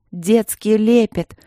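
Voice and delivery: slow, loud and firm, stress on лепет. A narrator's reading. Vasilina repeats firmly to Marina, slow loud firm. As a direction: repeat it hard, each word set down. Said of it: "повторила Василина твёрдо".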